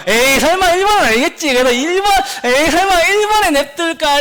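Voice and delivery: high, loud, incredulous student voice; hammed-up disbelief, imitating a student second-guessing; self-doubting student. Teacher acts out students not trusting themselves, high incredulous self-doubt. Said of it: "자기 스스로를 못 믿고".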